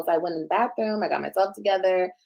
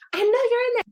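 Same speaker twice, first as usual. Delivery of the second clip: high-pitched imitation of the caseworker; high mock caller voice; 13 semitones higher than usual. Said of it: "she was like".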